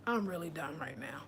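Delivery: whispered low, almost to herself; exhausted whisper. Real, not acted. Speaker to her live audience, drained, a whispered aside. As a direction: breathe it out, spent. Overwhelmed after heated speech.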